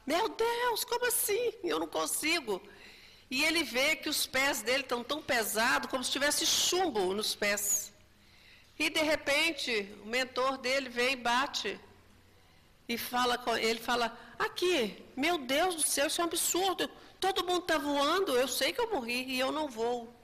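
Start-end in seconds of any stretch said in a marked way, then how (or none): 0.0-2.6 high, panicked cry of disbelief. Retold or imitated. Spirit can't fly and cries out high in panic. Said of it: "Aí ele começa a gritar". 14.4-20.1 indignant. Retold or imitated. Spirit complains to his mentor.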